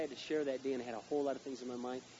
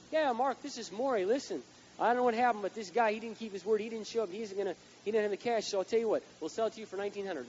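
higher, louder, eager salesman voice on a message; voicing the seller's voicemail; 6 semitones higher, 5 dB louder than usual. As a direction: play the seller selling hard on the phone. Voicemail from Maury retold.

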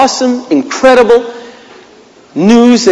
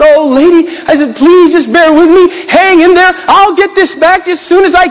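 much higher, louder begging; pleading; 11 semitones higher, 3 dB louder than usual. Retold or imitated. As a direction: beg the lady on the phone for more time. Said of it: "called the lady up and said".

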